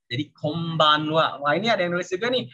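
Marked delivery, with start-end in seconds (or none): 0.3-1.4 "Kombanwa" slowly, with a clear m before b; n read as m. Shown as a demonstration. Teacher repeats the reading for learners, m sound in "Kombanwa". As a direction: close the lips for the m in "Kombanwa". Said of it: "bacanya jadi Kombanwa".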